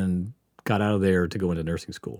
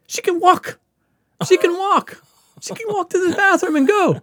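imitating the doctor, much higher and louder, hammering it home; angry doctor ranting; 5 dB louder than usual. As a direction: scold the patient like an outraged doctor. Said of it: "And he's so angry".

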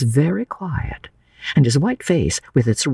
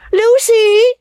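loud, high summons; shrieked-up, drawn-out name from afar; calling out; 7 dB louder than usual. A narrator's reading. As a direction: call her from the carriage. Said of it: "the voices called".

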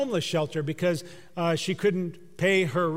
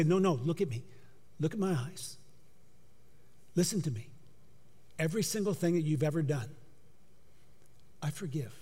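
tender reassurance; 8 dB quieter than usual. Pretend. Jesus speaking to a listener who looks down, tender. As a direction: say it softly, like forgiving a child. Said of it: "Jesus would say".

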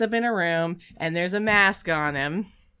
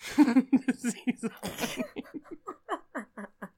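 long laugh; 4 semitones higher, 5 dB quieter than usual. Real, not acted. Laughing at pun.

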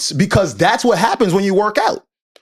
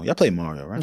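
funny low voice; 8 dB quieter than usual. Shown as a demonstration. Asking his friends about Mario in a much lower, softer voice. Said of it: "Why did you have to say it like that?".

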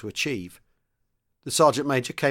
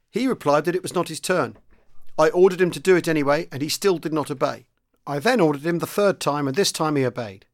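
higher, a touch louder formal recital; memorised report; 5 semitones higher, 4 dB louder than usual. Acted. Corporal reporting the charge to the squadron leader, stiff recited report. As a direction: deliver it like a rehearsed statement. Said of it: "Brooke had memorised the words".

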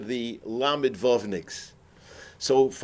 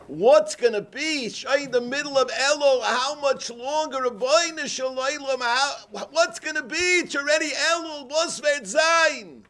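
wailing cry; 10 semitones higher than usual. Retold or imitated. Neighbor crying out to God at night, high pleading wail. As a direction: cry out to heaven in anguish.

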